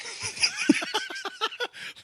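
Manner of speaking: a high, breaking burst of laughter. Real, not acted. Telling a buddy a Navy story, high laughing.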